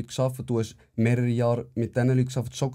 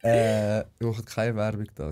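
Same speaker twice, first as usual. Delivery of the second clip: normal talking voice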